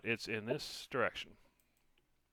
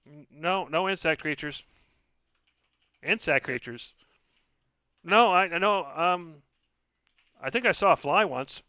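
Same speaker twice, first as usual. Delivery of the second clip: high, loud tiny voice; nervous little voice; 7 semitones higher, 10 dB louder than usual. Acted. Lily spirit voice.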